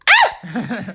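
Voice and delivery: short, high, loud mock scream; startled scream. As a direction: shriek in comic shock. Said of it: "Joisica is all like".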